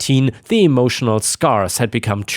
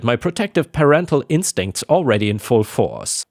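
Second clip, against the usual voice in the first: usual speaking voice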